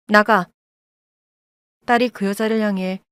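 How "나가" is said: loud, sharp order to get out; shouting at her mother. A narrator's reading. Daughter screams at birth mother to leave. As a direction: yell it to throw her out. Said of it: "나가라고 소리를 질렀습니다".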